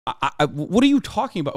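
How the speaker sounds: ordinary talk, nothing marked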